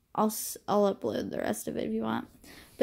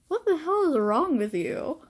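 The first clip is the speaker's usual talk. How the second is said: higher incredulous cry; lightly voiced exasperated guy; outraged boy; 6 semitones higher than usual. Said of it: "And he's just like".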